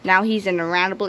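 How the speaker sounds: ordinary delivery, nothing marked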